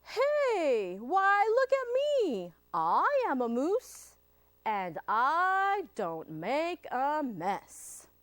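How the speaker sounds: high, loud moose calling out then slowing down; proud moose. Acted. The moose reveals himself to the reader, high and proud. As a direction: announce yourself like a proud moose.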